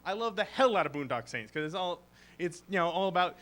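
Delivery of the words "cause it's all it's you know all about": normal talking voice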